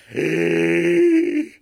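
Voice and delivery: long loud gasp of horror; horrified gasp. Retold or imitated. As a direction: gasp in shock like the frozen face. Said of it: "she's literally just making a noise".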